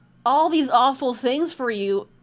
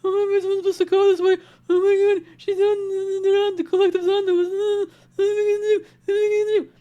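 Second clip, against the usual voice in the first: high, frantic, mounting panic, faster towards the end; mock panic; 9 semitones higher, 3 dB louder, faster than usual. The reader voices the guilty coven panicking, in high hammed-up fear. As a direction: ham up the cornered plotters freaking out. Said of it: "I'm just hearing panic".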